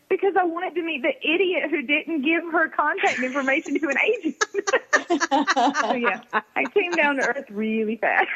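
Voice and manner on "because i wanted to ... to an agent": high-pitched retelling of a gleeful jab; a hint of mock-gushing, pitched up; lightly voiced teasing stranger. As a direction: lightly imitate a woman delivering a punchline. Retold stranger's teasing punchline.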